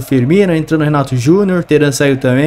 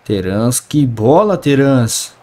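ordinary talk

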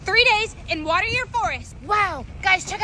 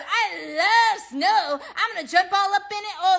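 ordinary talk

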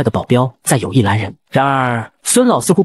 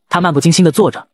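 usual speaking voice

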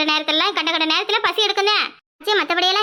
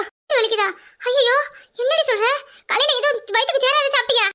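alarmed worry; 6 semitones higher than usual. Acted. வலி கேட்டு பதறும் அக்கா.

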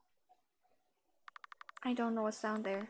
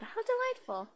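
character voice; 11 semitones higher than usual. Acted. Voicing the game character.

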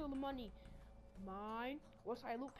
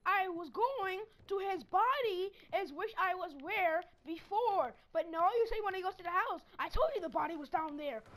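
raging rant; 4 semitones higher, 7 dB louder than usual. Real, not acted. Scolding the partner for going the wrong way, raging rant. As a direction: rant at him, fed up.

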